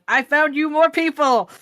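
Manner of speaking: loud, high burst of enthusiasm; enthusiastic, excited call. Acted. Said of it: "coming up very enthusiastically going".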